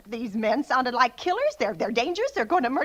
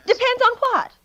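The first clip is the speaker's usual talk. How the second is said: sharp, raised, anxious demand; agitated high question; 7 semitones higher, 4 dB louder than usual. Acted. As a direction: pounce on the word. Agitated caller pressing operator.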